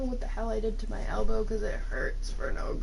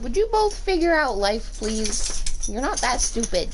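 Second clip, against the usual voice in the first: louder, higher fed-up plea; exasperated scolding; 5 semitones higher, 5 dB louder than usual. Real, not acted. Owner exasperated at her pets on the bed. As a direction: scold both pets in disbelief.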